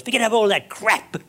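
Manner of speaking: ordinary delivery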